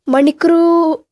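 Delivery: a botched first try at the word; flubbed start. Real, not acted. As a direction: misread false start. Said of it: "sorry, repeat".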